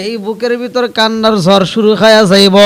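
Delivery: full-force melodic sermon; impassioned preaching. Real, not acted. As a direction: build from urgent singsong to a wail. Preacher builds toward the youth's cry.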